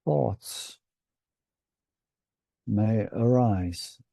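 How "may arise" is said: normal talking voice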